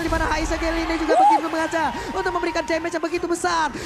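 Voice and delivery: high, loud, driving play-by-play; fighting game announcer. Acted. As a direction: call the team fight like it's live. Live team fight play-by-play.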